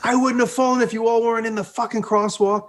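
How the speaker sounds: high mock rant without full volume; retold screaming. Fallen rider to the crowd in the crosswalk, mock screaming. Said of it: "And then I start screaming".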